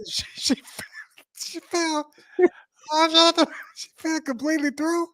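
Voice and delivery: high, laughter-choked voice; squeaking out the question between laughs; losing the fight against giggles; trying not to laugh. Real, not acted. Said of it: "Stop laughing".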